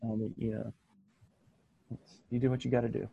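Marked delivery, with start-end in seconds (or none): none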